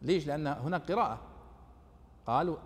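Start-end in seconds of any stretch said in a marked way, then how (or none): none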